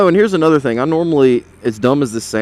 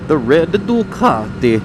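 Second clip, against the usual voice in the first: slow, drawn-out mock Italian accent; 3 semitones higher, slower than usual. Rider admiring another man's Ducati, drawn-out mock Italian accent. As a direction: savour it in a put-on Italian voice. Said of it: "Why am I doing an Italian voice?".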